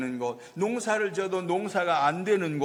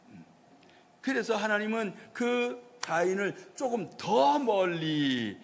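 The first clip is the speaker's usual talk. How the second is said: ordinary talk, nothing marked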